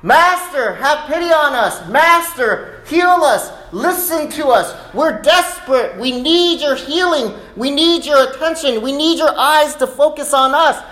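high, loud, urgent pleading; desperate shouted prayer. Acted. Lepers desperate for healing, high impassioned plea. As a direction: cry out to God, begging for healing. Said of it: "And this is a prayer".

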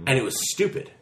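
ordinary talk, nothing marked

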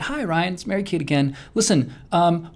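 politely sweet, lifted voice; Mary Kate. Retold or imitated. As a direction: imitate a very sweet woman on the phone. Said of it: "she very sweetly said".

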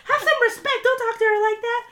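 high, loud imitated scolding; scolding shout. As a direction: yell at him as Kelly, mocking. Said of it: "Kelly chimes up".

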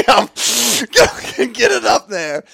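high, loud, drawn-out words through laughter; cracking up while telling it; laughing. Real, not acted. Laughing about his last binge.

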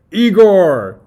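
high, booming mad-scientist call; Doctor Frankenstein. Acted. Doctor interrupts Igor, loud and high. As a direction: cut the assistant off grandly. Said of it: "starting with Doctor Frankenstein".

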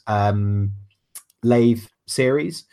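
ordinary talk, nothing marked

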